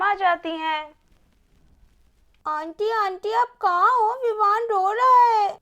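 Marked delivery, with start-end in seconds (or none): 2.5-5.6 put-on a young girl's voice, calling out louder; little girl calling. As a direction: call for auntie urgently, like a small child. Child calls aunt about crying baby. (छोटी बच्ची की ऊँची पुकार)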